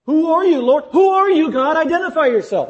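loud, very high-pitched cry to heaven; urgent demand. Acted. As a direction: demand an answer at full voice. Acted alarmed demand to God.

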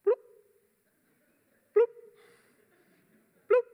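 a lone mouth-made bloop, then a long wait for the next; dripping bloop noise. Retold or imitated. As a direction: imitate a trickling shower drop by drop. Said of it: "You know what my water's doing?".

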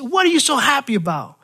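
resentful sailor snapping at a singing shipmate; annoyed sailor. Retold or imitated. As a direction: jab the question at the happy sailor. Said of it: "really upset".

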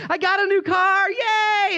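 very high, shouted cheer; mock elation. Preacher acting a proud new car owner, hammed-up elation. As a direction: cheer in an over-the-top high voice.

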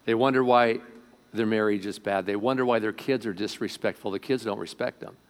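ordinary delivery

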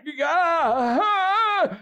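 high, loud anguished yell; retold scream of a man collapsing; pained cry. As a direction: cry out as if struck by pain.

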